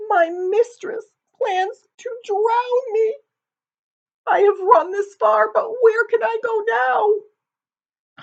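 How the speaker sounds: a sad cat moaning her woes. Acted. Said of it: "moaned the cat".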